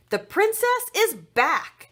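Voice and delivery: high, loud and slow; heroic announcement. Acted. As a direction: proclaim it like a superhero.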